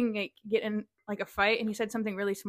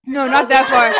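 laughing denial; 3 semitones higher, 15 dB louder, slower than usual. Real, not acted.

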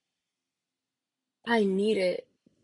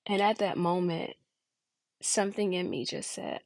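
ordinary talk

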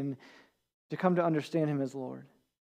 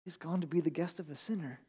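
muttering crowd; 12 dB quieter than usual. A narrator's reading. Crowd grumbling about Jesus and the sinner, muttered softly. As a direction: mutter it under your breath like a disapproving crowd. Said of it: "began to mutter".

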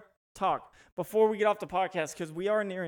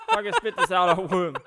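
laughing disgust; 3 semitones higher, 8 dB louder than usual. Real, not acted. Disgusted at gamey meat.

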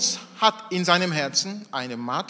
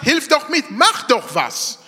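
exasperated nagging; 7 semitones higher, 5 dB louder than usual. Retold or imitated. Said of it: "Sie hat zweimal, dreimal gesagt".

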